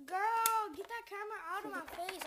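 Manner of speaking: shouted, annoyed protest; loud protest. Real, not acted. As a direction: yell it at whoever is filming. Telling someone off the camera.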